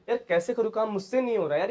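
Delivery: ordinary delivery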